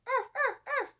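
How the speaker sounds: high yappy dog barks; puppy barks. A narrator's reading. Puppy bouncing at the chief's feet, yapping. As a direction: bark like an eager puppy.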